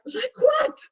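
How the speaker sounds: sarcastic retort. Retold or imitated. To the woman who mocked her accent, high sarcastic retort. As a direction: toss it back with pointed sarcasm. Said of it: "I'm being sarcastic".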